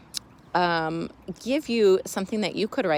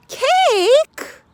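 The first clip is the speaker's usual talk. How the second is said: very high loud relieved question; surprised cake; 8 dB louder than usual. Acted. Mouse hearing it is only cake, high surprise. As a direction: squeak it out in relief.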